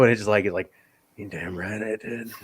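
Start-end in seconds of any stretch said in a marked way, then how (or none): 1.2-2.3 much softer and lower, unbothered; matter-of-fact confession. Retold or imitated. Lawrence admitting he shot Bravo, low matter-of-fact confession. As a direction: imitate a casual, cool admission. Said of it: "matter of fact".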